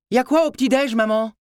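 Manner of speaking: voix d'ado aiguë qui lance sa question de loin; cri d'enfant. A narrator's reading. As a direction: appeler sa mère depuis sa chambre. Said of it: "cria Tyler". (garçon qui crie depuis l'étage)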